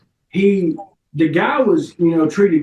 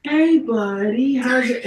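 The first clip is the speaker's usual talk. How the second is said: high, slow, syrupy talking down; baby voice; 8 semitones higher, much slower than usual. Retold or imitated. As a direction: coo it like to a toddler. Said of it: "His wife got down and was like".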